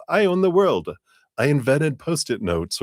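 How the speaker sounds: high, hammed-up childlike boasting; boastful heart. Heart promising the world, high boastful mock voice. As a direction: crow it high, like an overexcited child. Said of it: "the heart will just promise the world".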